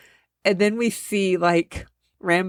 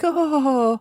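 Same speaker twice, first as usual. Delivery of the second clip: surprised gasp; 8 semitones higher than usual. Retold or imitated. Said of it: "She's like".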